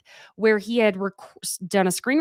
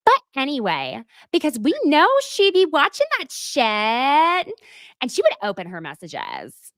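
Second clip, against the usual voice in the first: catty quoted gossip; 7 semitones higher than usual. Retold or imitated. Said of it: "it keeps going".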